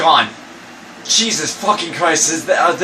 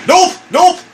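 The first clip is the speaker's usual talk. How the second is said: panicked; 13 semitones higher, 6 dB louder than usual. Real, not acted. Panicking at the statue.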